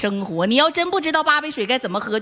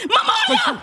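sudden very high shriek; comic startled cry; startled scream. Acted. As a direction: yelp in shock, pitch leaping up. Composed mother suddenly startled mid-boast.

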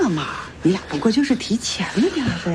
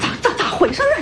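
high, stammering disbelief; shocked; 12 semitones higher than usual. Acted. The old aunt demands what happened, shocked. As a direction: stumble over it in shock.

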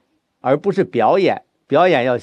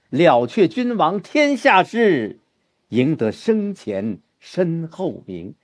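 slow, fading toward the end; turning down; 4 semitones higher, much slower than usual. Shown as a demonstration. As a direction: let the line sink slowly. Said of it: "你看这个里边就下来了". (放慢，语调下沉)

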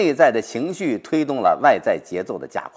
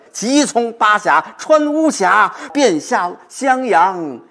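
much higher and louder, drawn out slow at the end; urgent recitation; 10 semitones higher, 5 dB louder, much slower than usual. Shown as a demonstration. Professor showing the hurry of the journey home. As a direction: soar up eagerly, stretch 向洛阳. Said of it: "着急了".

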